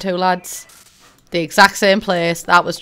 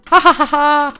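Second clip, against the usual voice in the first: mock laugh; 6 semitones higher, 7 dB louder than usual. Typed laugh read aloud.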